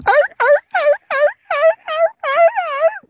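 very high, loud repeated dog yelps; dog screaming in pain. Acted. Bar dog swung by its tail, imitated pained yelping. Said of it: "Poor dog screaming in pain".

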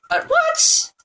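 sudden high-pitched, startled "what"; squeaky startled question; shocked squeak. Real, not acted. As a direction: jump way up in surprise. Sudden glitch in the game.

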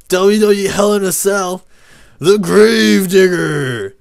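loud, high roaring ring announcer; hyped-up wrestling hype shout. Pretend. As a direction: bellow the match title, stretching the last words.

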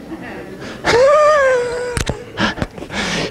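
high burst of laughter; sudden high laugh; real laugh. Real, not acted. Amused at Perry.

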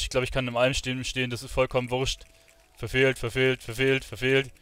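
ordinary delivery

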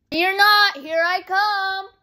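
loud, slow seeker call. Real, not acted. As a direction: announce it to the hiders. Seeker calls out.

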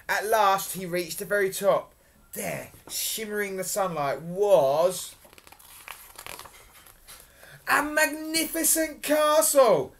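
loud build to a high reveal; dramatic storytelling. A narrator's reading. Storyteller revealing the castle to children, dramatically. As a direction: hold a long pause, then announce it grandly.

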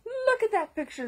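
high pushy command; demanding. Acted. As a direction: order them to look. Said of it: "they demanded".

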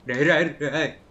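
sharp loud call of రే, రే. Real, not acted. Gamer yelling at his climbing character, a sharp loud call. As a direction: shout రే, రే at the character to stop him.

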